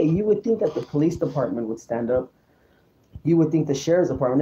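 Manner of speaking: ordinary talk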